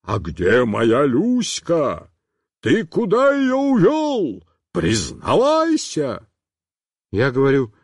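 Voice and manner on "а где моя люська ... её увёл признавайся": slow, angry demand; a man banging the fence, demanding his dog. A narrator's reading. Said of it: "кулаками стучит".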